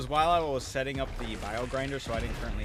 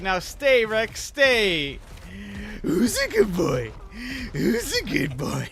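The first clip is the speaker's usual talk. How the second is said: louder, higher, slow commanding pet talk; SpongeBob voice; 8 semitones higher, 7 dB louder, much slower than usual. Retold or imitated. Imitating SpongeBob ordering his pet worm, loud and slow. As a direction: boom it out like training a pet. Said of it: "He's like".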